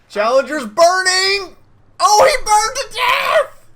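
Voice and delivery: high, slow, shouted hype; excited shout. Real, not acted. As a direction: yell it out like a big kill. Excited game commentary kill.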